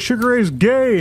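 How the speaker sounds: high-pitched imitation of a teen heckler; mock heckle. Retelling a teenager heckling Sugar Ray's singer, high mock heckle. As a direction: toss it up high like a taunt.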